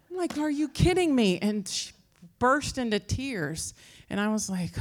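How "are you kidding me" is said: high, louder incredulous mock snap; retold exasperated outburst. Woman retells snapping at her houseguest, exasperated. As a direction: replay the moment of losing patience.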